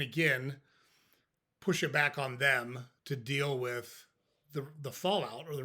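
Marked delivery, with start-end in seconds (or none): none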